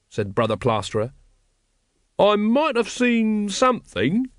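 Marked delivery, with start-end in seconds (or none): 2.2-4.3 high, slow, eager-to-agree character voice; loyal. A narrator's reading. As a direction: offer support in a drawn-out, raised pitch. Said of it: "said Brother Watchtower loyally".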